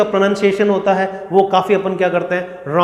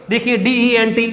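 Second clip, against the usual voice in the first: ordinary talk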